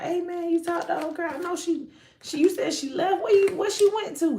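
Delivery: high, casual questions pretending not to know; lightly voiced nosy friend; fake-innocent friend. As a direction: act like you know nothing. Voices the dude feigning ignorance.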